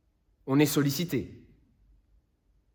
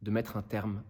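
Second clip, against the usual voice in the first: low, hushed plea sinking towards a near whisper; grave closing words with long pauses, fading away; solemn appeal; 3 semitones lower, 4 dB quieter than usual. Acted. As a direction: plead from the heart, softly and gravely. Lawyer's solemn final appeal to jury.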